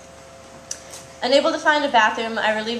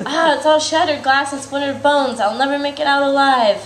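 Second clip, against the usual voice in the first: mock dread broken by laughter, louder and higher; laughing panic; 5 semitones higher, 5 dB louder than usual. Comic telling her dream panic, laughing mock despair. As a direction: laugh through the fake despair.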